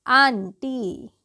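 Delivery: आंटी said very slowly with a long open "aa"; drawn-out model word. Shown as a demonstration. Teacher to students, आंटी drawn out slowly. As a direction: stretch आंटी out slowly for the class. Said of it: "अब देखिए".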